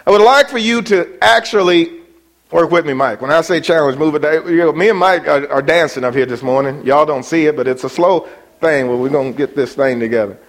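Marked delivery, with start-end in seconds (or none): none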